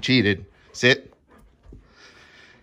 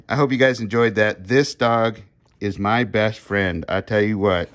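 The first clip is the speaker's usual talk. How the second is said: ordinary delivery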